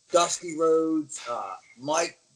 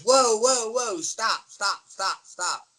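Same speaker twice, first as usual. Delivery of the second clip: high, fading call to halt; commanding whoa fading off; calling to stop; 7 semitones higher, slower than usual. Retold or imitated. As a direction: halt the match mid-move. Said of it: "he goes".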